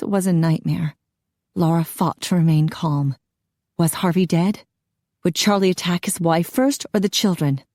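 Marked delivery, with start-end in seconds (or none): none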